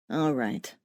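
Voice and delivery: low voice of a self-satisfied dead man; smug dead man. A narrator's reading. Lyle's dialogue.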